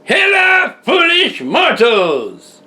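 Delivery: slow booming villain shout; booming demon lord. Acted. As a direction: boom out the greeting like a haunted host. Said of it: "That's not right".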